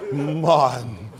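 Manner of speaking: low-pitched mock calling of a name; imitating Coretta. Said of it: "It's the way she throw Martin's name".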